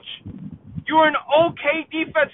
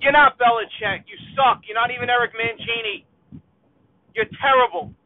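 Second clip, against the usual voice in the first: ordinary talk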